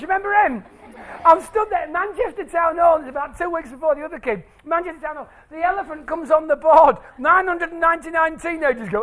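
ordinary delivery

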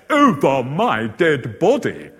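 slow, loud, word-by-word veto; mock vehement refusal. Panel member refusing the book, mock-emphatic refusal. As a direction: imitate a judge digging in, each word stressed.

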